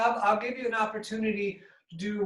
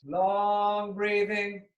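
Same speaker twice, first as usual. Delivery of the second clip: very slow, sustained words; drawn-out breathing cue; 2 semitones higher, much slower than usual. Real, not acted. Yoga teacher guiding the class through breathing, slow and drawn out. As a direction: stretch the words out like a long breath.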